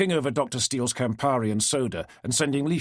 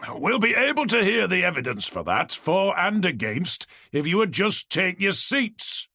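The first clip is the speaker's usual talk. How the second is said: booming moderator; 8 semitones higher than usual. Acted. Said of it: "boomed Wycliffe".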